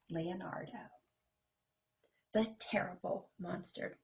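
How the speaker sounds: ordinary delivery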